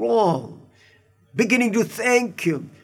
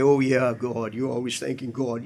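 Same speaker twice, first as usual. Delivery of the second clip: mild mockery; 7 semitones lower, 4 dB quieter than usual. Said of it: "people make fun of us".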